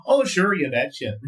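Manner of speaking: bright sing-song Minnesotan, pitch up on Oh; Minnesotan accent. Shown as a demonstration. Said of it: "it's the only phrase I can do".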